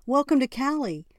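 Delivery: high, loud dopey greeting; over-eager, smitten welcome, loud and high; goofy eager welcome. A narrator's reading. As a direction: welcome her with a big dumb grin. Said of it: "a big Dumbo smile on his face".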